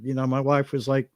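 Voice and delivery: ordinary talk, nothing marked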